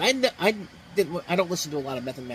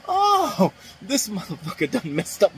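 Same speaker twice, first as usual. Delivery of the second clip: a high yelp on "Oh," then a gruff put-on voice; imitating a stunned outburst; 7 semitones higher, 4 dB louder, slower than usual. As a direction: play the stunned guy getting hit. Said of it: "the way he says it".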